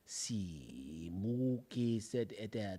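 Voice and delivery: low, soft fake Spanish in an African-like accent; halting, hushed gibberish in an African-tinged accent; botched African-sounding accent. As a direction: mumble mock Spanish with a wandering African accent. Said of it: "it was African".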